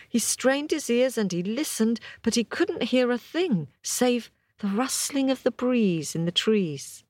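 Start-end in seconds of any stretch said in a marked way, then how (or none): none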